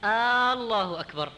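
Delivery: الله opening on a long stretched "aa": aallahu akbar; slow الله أكبر with a prolonged opening alif; second hamza added. Shown as a demonstration. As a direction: drag out the first vowel of الله. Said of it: "أدخل عليها همزة ثانية".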